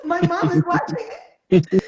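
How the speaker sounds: laughing. Real, not acted. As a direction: giggle it out. Laughing about mom watching.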